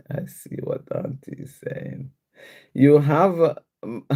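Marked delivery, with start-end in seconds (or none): 0.1-2.1 breathy whispered aside, no voice at all. Real, not acted. Host reading chat, whispering an aside to herself. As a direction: drop to a bare whisper as if to yourself.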